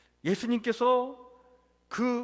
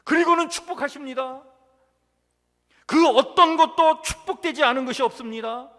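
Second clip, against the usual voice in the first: high, raised preaching voice; forceful proclamation; 8 semitones higher, 8 dB louder than usual. Acted. Preacher assuring the congregation of blessing, high and forceful. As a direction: proclaim it with full pulpit conviction.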